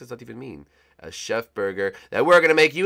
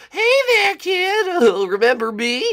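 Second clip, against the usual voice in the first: gloating villain; 12 semitones higher, 3 dB louder than usual. Acted. Villain greets captive gleefully.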